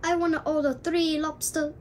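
mock Chinese-accented takeout order. Caller ordering seafood, in a Chinese accent. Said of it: "I know the accent is like this".

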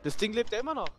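high astonished outcry; shocked disbelief. Real, not acted. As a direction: shout it in disbelief. Boss still alive.

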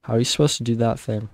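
usual speaking voice

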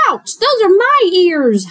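high, loud yelp; hollered. A narrator's reading. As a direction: holler it as your ears get grabbed. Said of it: "hollered Hare".

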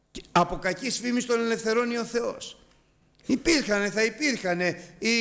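high-pitched impassioned sermon; preacher rising to a fervent peak; fervent preaching. Acted. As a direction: lift the voice and preach it out. Preaching climax.

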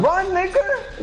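high, drawn-out yell to flee; stretched, high urgent warning; urgent shout. Real, not acted. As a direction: call out in alarm to a teammate. Urging a teammate to run.

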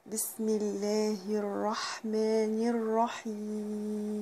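slow, drawn-out chanted recitation; slow tajweed chant; Quran recitation. Shown as a demonstration. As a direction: recite each word long and measured.

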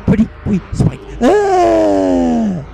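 high, loud mocking yelp on ah. Mocking the song's hook to viewers, high yelped ah. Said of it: "He's saying".

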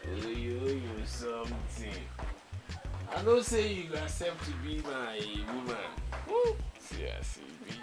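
smooth sweet talk. Acted. Man sweet-talking.

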